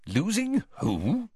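high, slow bewildered question; incredulous query; baffled. A narrator's reading. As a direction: stretch it out in puzzlement. Prime Minister puzzled by the name.

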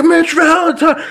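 high, drawn-out mock fan cry; hardcore fan. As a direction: imitate a starstruck driver recognizing a wrestler. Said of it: "like, a hardcore A P W fan".

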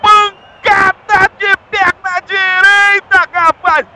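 shouting high over the crowd; explosive goal commentary. Real, not acted. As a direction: celebrate the goal at full voice. (narração de gol explosiva)

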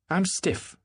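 high complaining whine. Acted. Miss Baker rising from the sofa, high complaint. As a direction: moan about being stiff. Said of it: "she complained".

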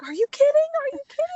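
very high imitation of an overcome mom; tearful disbelief. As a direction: squeal it as mom bursting into happy tears. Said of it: "my mom just starts crying".

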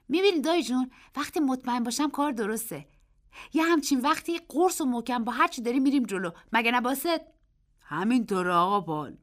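put-on high, loud and quick boyish voice; a boy swept up explaining his luck; excited boy. A narrator's reading.

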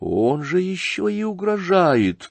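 ordinary delivery

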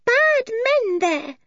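put-on very high, slow girl's voice; high-pitched, drawn-out warning; urgent child's warning. A narrator's reading. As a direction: warn slowly in a high little voice. Eily warns Julian of danger.

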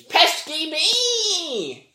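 very high, slow, loud squawk; screamed bird voice. Retold or imitated. The film's Pokemon scream, loud slow squawk. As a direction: scream it out slowly. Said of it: "Pokemon screams".

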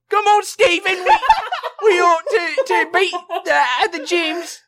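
very high falsetto British accent, laughing; British accent Garnet. Shown as a demonstration. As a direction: camp squeaky British accent, hammed up. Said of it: "my best British accent for Garnet".

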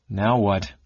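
usual speaking voice

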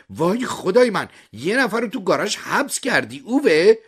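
high, loud scream of disbelief; shocked shriek. A narrator's reading. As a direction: shriek it in alarmed accusation. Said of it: "جیغ زد".

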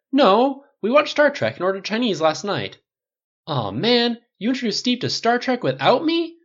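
scandalised friend on the phone; excited outburst. Acted. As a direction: cry out in mock betrayal. Rhodey's outraged delight.

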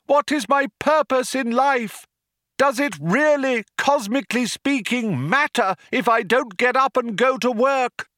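rally the crowd over a tannoy; crying out to crowd. A narrator's reading. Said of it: "cried the man".

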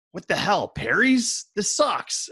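high, incredulous retold protest; mock outrage. Partygoers outraged at cheap substitute.

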